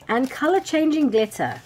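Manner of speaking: loud, high flourish; excited announcement. Real, not acted. Vlogger unveils the glitter to viewers, excited. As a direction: unveil it with a big lift.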